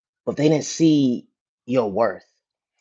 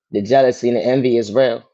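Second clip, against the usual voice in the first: ordinary talk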